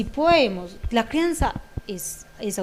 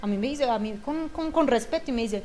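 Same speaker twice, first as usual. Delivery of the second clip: usual speaking voice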